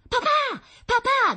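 put-on very high, loud child's shout; child's cry. A narrator's reading. Daughter calling down to her father, shouting high. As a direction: call out excitedly in a little girl's voice. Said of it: "gridò Rita".

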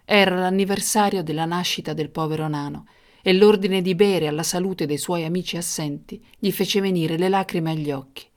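ordinary delivery

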